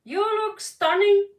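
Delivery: high, loud gushing; excited compliment. Real, not acted. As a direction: gush over them. Complimenting viewers.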